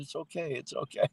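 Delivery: soft reassurance. Retold or imitated. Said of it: "I was really calm".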